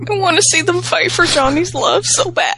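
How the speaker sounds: high, eager and gushing; giddy excitement. Real, not acted. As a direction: squeal it like a fan wanting drama. Giddy over a catfight idea.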